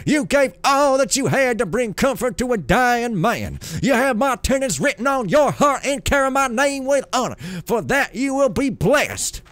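loud, high preacher's blessing; booming divine lord. Acted. Builder blessing the poor novice, booming proclamation.

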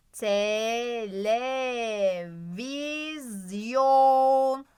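"Televizyon" very slowly, every vowel held, swelling on "yon"; stretched syllables. Shown as a demonstration. Teacher stressing O for pupils, very drawn-out "Televizyon". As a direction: sustain "Televizyon" syllable by syllable into a big o. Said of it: "Ortasında vurguluyorum".

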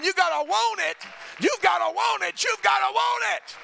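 loud, high, shouting preacher climax; roaring revival preaching; full-force preaching. Acted. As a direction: preach it hard to the whole church.

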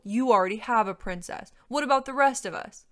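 loud dragon complaint; grumbling. A narrator's reading. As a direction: protest the unfairness. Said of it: "one of the other dragons grumbled".

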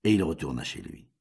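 usual speaking voice

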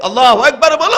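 loud, high call for a response; rising preacher's rallying cry; rallying the congregation. Real, not acted. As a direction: shout it out so the crowd answers. Calls on the crowd to respond.

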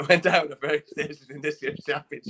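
amused, laughing as you speak. Real, not acted. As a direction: let laughter lift the words. Joking about players going down.

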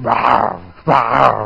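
low dog growl. Shown as a demonstration. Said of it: "Use a dog that says".

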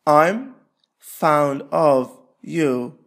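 normal talking voice

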